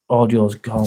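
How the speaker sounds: ordinary delivery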